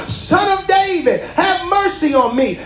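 high, shouted plea; crying out. Retold or imitated. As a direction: call out for mercy over the crowd. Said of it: "began to cry out".

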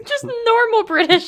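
mock the phrase while cracking up; laughing echo. Real, not acted. Echoing a cohost's words about Wallace, laughing and high.

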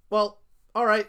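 loud, flat-toned shrug of a line; loud mock robot reply. Robot bounty hunter shrugs at the bar crowd, loud. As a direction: toss it off loudly like an unbothered droid.

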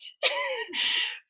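high giggle, falling; short high laugh that drops. Real, not acted. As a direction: laugh at the shock. Laughing after "What?.

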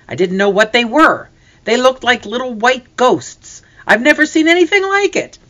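high, urgent account climbing in pitch; agitated. A narrator's reading. Hunter telling the men what scared him, agitated. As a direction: build to a high, loud, astonished finish. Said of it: "He was breathing hard".

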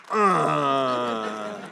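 a crying catch on uh; tears catching the voice; voice breaking. Real, not acted. Survivor overcome mid-testimony, real crying catch.

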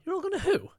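very high, baffled mock question; mock confused question. Retelling mate's mix-up, very high baffled question. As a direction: squeak it out in confusion. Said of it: "I'm like".

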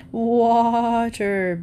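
bleating sheep. Acted. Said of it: "bleated the sheep".

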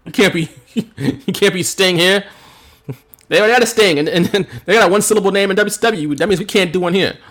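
half-hearted bossy executive voice, laughing; a hint of a blustering booker laying down rules; mock WWE boss. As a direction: lightly play a fussy boss banning names. Mocking WWE bosses' rules.

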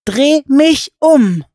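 Alice gasping out a plea to the giant; breathless shout. A narrator's reading. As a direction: force it out upside down. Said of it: "atemlos ... hervorzustoßen".

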